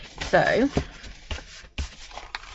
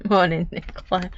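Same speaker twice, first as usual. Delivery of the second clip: ordinary talk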